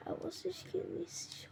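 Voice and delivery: hush, so it doesn't notice you; sneaky whisper. Real, not acted. Gamer sneaking past a mob, whispering. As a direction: whisper it under your breath.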